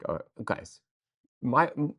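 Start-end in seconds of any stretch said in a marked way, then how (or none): none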